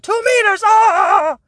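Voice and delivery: mock panicked shriek, very high and loud. Mocking people freaking out.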